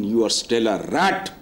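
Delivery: you are still a rat said very slowly, word by word; slow punchline. Shown as a demonstration. Guest quotes the wise to host, slowly for effect. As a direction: let the proverb land, slow and deliberate. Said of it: "कहते हैं सियाने कि".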